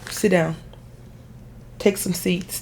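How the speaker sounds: ordinary delivery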